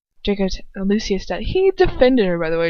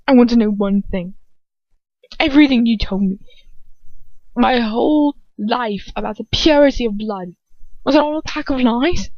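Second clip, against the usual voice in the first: louder and climbing higher; rising anger; 4 semitones higher, 5 dB louder than usual. Acted. Draco confronting his father, anger building. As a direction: build toward a furious question.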